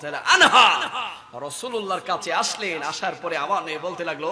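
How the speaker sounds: ordinary delivery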